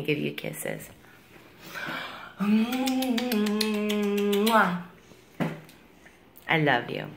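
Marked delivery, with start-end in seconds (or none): 2.4-4.8 long soft kiss; drawn-out gentle kiss. Real, not acted. Mommy's gonna give you kisses.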